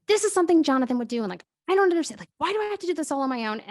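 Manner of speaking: frustrated. Real, not acted. Venting frustration at doing it alone.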